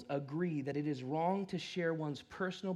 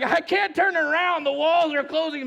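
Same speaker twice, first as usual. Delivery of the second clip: panicked; 10 semitones higher, 11 dB louder than usual. Retold or imitated. Panicking driver in narrow city.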